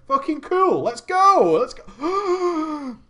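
rallying cry, hammed up; mock excitement. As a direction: imitate gamers cheering a new server. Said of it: "All these guys in the E U are like".

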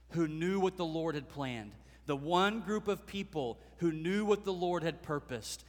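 normal talking voice

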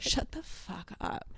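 breathless, hushed disbelief; stunned whisper. Real, not acted. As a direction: whisper it in shock. Reacting to spooky story.

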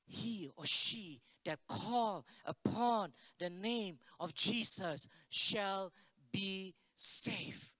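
very slow, word by word; solemn verse recitation. Real, not acted. Preacher recites remembered verse to congregation, slow and solemn. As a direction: weigh every word of scripture, drawn out.

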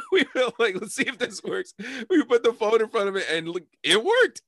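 high giggling through the words; laughing. Real, not acted. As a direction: laugh while telling the punchline.

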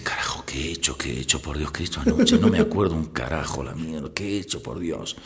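low, hushed, whispery self-questioning; dismayed hungover muttering. Acted. Hungover husband waking with no memory of last night, hushed dismay. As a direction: mutter it to yourself in quiet dread. Hungover man wakes, remembers nothing.

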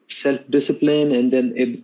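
usual speaking voice

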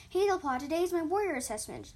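high, loud kitten announcement; eager kitten meow. A narrator's reading. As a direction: announce the big news loudly. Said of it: "Ginger Kit meowed".

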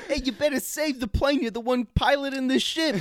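mock Wahlberg. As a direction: snap orders like a movie hero. Wahlberg voice in crash scene.

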